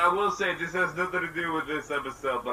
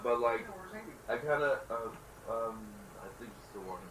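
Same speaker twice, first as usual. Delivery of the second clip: normal talking voice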